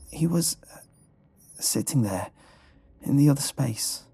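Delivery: halting, uneasy recollection; frightened dreamer, pausing between fragments; shaken. A narrator's reading. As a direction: grope for the words, still shaken. Shaken dreamer recounts the nightmare.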